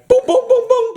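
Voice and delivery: high, rapid-fire "boom" sound effect; playful high-pitched booms; imitated booming noises. Imitates Superman's booming back and forth.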